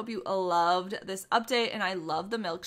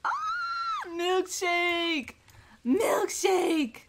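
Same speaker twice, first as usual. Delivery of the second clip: excited call; 8 semitones higher, much slower than usual. Real, not acted. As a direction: shout the name with delight. Calling out the pet's name.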